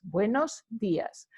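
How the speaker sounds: Buenos dias slowly, leaning on the í of dias; slow stress demo. Shown as a demonstration. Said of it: "the accent in the I ... place the emphasis".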